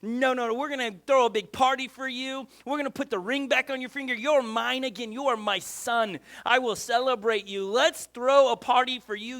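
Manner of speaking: loud, booming, joyful welcome home; overjoyed father. Retold or imitated. Father welcoming his prodigal son home, loud and joyful. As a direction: shout the welcome like a father bursting with joy. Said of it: "And the dad goes".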